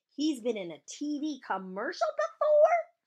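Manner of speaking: loud, high incredulous question; astonished rising question; amazed echo. Acted. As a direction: repeat it in disbelief, climbing to the end. Amazed echo of friend's claim.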